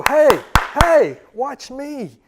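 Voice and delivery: high, loud call for attention; high excited dog call. Shown as a demonstration. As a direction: call the dog's name sharply upward. Trainer calling the dog.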